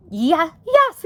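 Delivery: high, loud Homley, a touch urgent; exclaiming. A narrator's reading. Wife demands the letter, exclaiming. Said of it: "exclaimed Homley".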